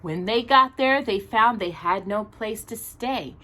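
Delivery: gentle rhyming storybook read; reading to children. Acted. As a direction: read the picture book aloud to little listeners. Reads a Christmas storybook aloud.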